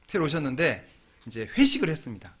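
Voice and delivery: usual speaking voice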